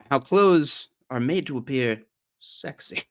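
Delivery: imitated creepy, lingering delivery; creepy speaker. Streamer imitating how he says the clothing line, creepy and lingering. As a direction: linger creepily on the last word. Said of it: "The way he says that is so creepy".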